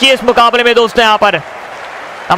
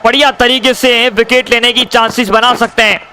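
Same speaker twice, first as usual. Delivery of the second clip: normal talking voice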